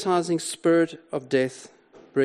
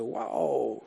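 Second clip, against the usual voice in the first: ordinary talk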